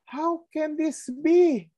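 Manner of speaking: high, slow, incredulous question; a high, slow, stunned Nicodemus; voicing Nicodemus. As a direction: draw it out like a baffled scholar. Nicodemus quoted again, slower.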